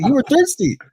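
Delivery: hammed-up cellmate voice saying the punchline again; cellmate's punchline. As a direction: repeat his line in a put-on voice through laughter. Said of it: "He's like".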